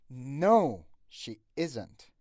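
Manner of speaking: "No" punched loud, "she" kept quiet before "isn't"; stress on no, soft she. Shown as a demonstration. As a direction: hit "No", slip lightly over "she".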